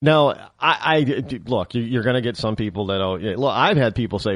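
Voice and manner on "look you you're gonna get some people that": usual speaking voice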